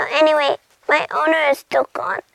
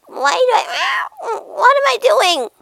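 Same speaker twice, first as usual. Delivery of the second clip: high-pitched, puzzled questioning; bewildered cat; 9 semitones higher, 3 dB louder than usual. Acted. Cat asking why it meows, high and baffled. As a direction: pitch it way up in confusion.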